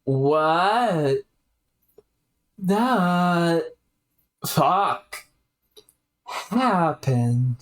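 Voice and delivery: lightly voiced stunned character, slow; a hint of a bewildered youth; shocked game character. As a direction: blurt it out in dazed disbelief.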